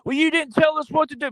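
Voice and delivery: high whiny mock voice of blaming citizens; mocking complainers. Pundit mocks the public blaming the president, high whiny voice. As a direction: sneer it like a petty grumbler. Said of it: "then what are people gonna do".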